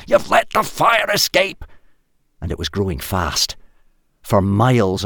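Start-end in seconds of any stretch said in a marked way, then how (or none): 0.1-1.7 much higher, loud accusing yell; furious scream. A narrator's reading. Hal yelling at the tribe about the fire, furious. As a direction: shout the blame. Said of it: "screamed Hal".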